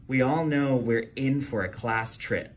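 ordinary delivery, nothing marked

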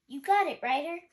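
high, loud eager answer; eager reply. Acted. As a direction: answer keen and ready. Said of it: "Sky answered".

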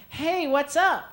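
put-on high, chirpy girl voice; girl texting. Girlfriend texting her boyfriend from lunch, high and chirpy. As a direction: play the bubbly girlfriend opening a chat. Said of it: "First one's from the girl".

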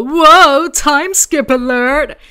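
loud, high, drawn-out alert; exaggerated shouted announcement; mock alarm. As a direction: shout it like a silly warning siren. Mock alarm at a time skip.

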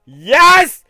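high loud victory shouts; excited shouting. Real, not acted. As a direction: shout with glee. Celebrating level progress.

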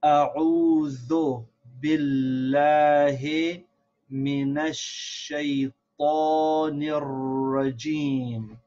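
measured Arabic recitation with held vowels; drawn-out, carefully articulated reading; slow model recitation. Shown as a demonstration. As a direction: recite phrase by phrase for learners. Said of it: "we'll read from the beginning".

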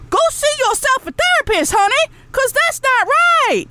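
high, shouted put-down; sassy shout. Real, not acted. Telling viewers off.

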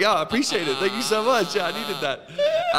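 high, loud, gushing thanks; mock gratitude. Acts out naive thanks to thief.

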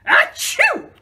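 high piercing sneeze; explosive cartoon sneeze; loud sneeze. Sneeze in story.

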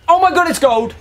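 high delighted yelp at a gold card; excited. Real, not acted. Gamer thrilled at a gold pack, excited squeal.

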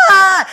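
loud, high mock wail; mock bawling. Shown as a demonstration. Comic showing how men should just cry, mock bawling. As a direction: burst out in a hammed-up cry. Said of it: "pues que lloren".

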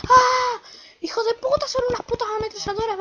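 high, loud panicked cursing; startled yelling. Real, not acted. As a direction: yell in shock at the guns. Ambushed by machine guns.

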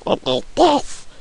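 high, voiceless slow squeak; weird broken voice. Shown as a demonstration. Gamer shows the cold's effect, high broken voice. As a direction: let the voice give out up high. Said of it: "making my voice do weird things".

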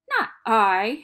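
loud hissing cat. A narrator's reading. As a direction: hiss it like a cat. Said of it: "hissed the cat".

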